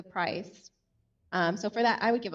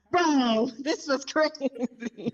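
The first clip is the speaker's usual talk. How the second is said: higher, louder, slow "Bro, this was crazy"; hammed-up bro; 4 semitones higher, 5 dB louder, much slower than usual. Hammed-up bro voice mocking the intro, sketch-show style. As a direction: ham up the surfer bro. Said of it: "I feel like I'm getting Saturday Night Live".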